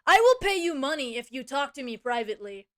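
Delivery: loud, stilted parody of a bot; mock human talk. Streamer mocking scam bot's private offer, stilted. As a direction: declaim it like a fake human. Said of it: "that's how real humans talk".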